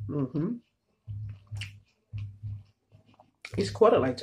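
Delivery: chewing while talking; mouth full. Real, not acted. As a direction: between bites of food. Seen 4: Closed-mouth chewing through "mm-hmm"; speaks between bites of food.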